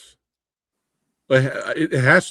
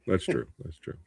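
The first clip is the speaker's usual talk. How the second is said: much softer, trailing off like an echo; fading echo; 6 semitones lower, 11 dB quieter than usual. Acted. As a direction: let it die away. Agreeing, repeated fading out.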